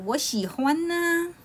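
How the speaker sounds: put-on high, drawn-out little-boy voice; cute kid voice, stretching the words; child's reply. A narrator's reading. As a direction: answer slowly in a high childlike pitch. Child answers his father.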